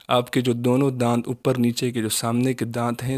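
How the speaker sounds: usual speaking voice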